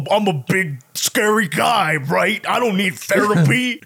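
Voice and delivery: slow, lightly voiced macho bravado; tough guy Sam. Sam denying he needs therapy, light mock bravado. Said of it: "Sam is like".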